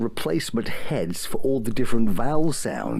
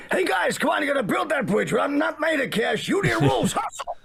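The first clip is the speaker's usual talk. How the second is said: much higher, shouty bossy builder; angry Bob the Builder. Acted. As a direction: bark orders at the crew. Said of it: "maybe something less angry".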